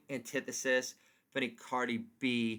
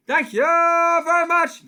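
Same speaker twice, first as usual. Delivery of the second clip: over-the-top comic sign-off; 13 semitones higher, 12 dB louder than usual. Acted. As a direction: belt it like a lounge comic.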